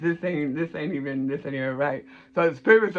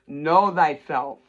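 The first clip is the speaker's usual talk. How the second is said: Know thyself said very slowly, each word weighted; slow emphatic maxim; much slower than usual. Real, not acted. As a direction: deliver it like ancient wisdom. Advice delivered as maxim.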